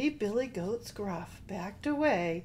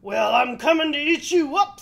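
loud, high, threatening troll; 7 semitones higher, 12 dB louder than usual. A narrator's reading. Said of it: "said the troll".

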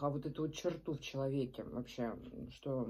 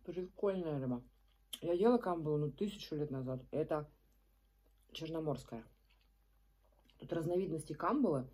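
speaking with food in mouth; eating between words; talking while chewing. Real, not acted. Seen 2: Food bitten just before; she talks, picking fish, bites again.

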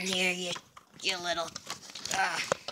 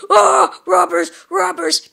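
high loud alarm cry; alarmed shout; 6 semitones higher, 8 dB louder than usual. Acted. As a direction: yell a warning. Character spots robbers, alarms.

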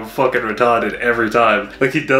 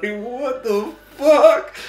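disbelief; 12 semitones higher, much slower than usual. Retold or imitated. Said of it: "I was like".

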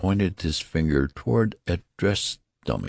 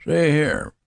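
put-on high-pitched old man breaking in; sudden announcement; 11 semitones higher, 4 dB louder than usual. A narrator's reading. Old newborn interrupting his father, sudden announcement. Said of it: "the old man announced suddenly".

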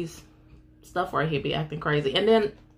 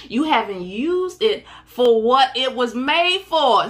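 impassioned preaching; 5 semitones higher, 3 dB louder than usual. Acted. Preaching at full force.

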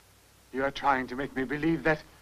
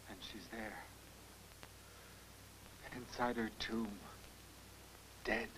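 very soft, low, halting words; hushed horror; 7 semitones lower, 19 dB quieter than usual. Acted. Man recalling Elfie in her tomb, hushed. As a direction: let it fall almost to nothing, with long pauses. Horrified memory of dead daughter.

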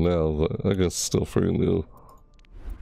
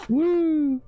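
very high excited woo; high cheer. Real, not acted. Gamer cheering a new skin, excited.

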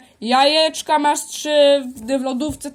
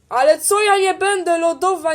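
indignant higher retort; 6 semitones higher, 5 dB louder than usual. Acted. Powtórka tej samej kwestii, wyżej.

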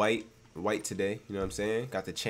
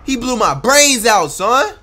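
loud, higher and slow disbelieving shout; louder, high outraged outcry; shocked outcry; 12 semitones higher, 14 dB louder, much slower than usual. Real, not acted. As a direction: bellow it in stunned disbelief. Reacting to being shot.

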